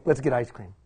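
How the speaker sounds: deadpan the cure-all line; wry punchline. Shown as a demonstration. Speaker mocks dads' cure-all to the audience, wry aside. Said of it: "that's the answer for everything".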